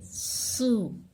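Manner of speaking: सू with a hissed whistling s. Shown as a demonstration. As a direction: let the s of सू whistle. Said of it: "सू को सीटी की आवाज निकलनी चाहिए".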